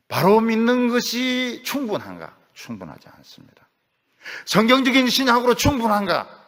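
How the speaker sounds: loud, high rhetorical questions from the pulpit; fiery preaching. Real, not acted. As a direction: hammer the question at the congregation. Preaching at full force.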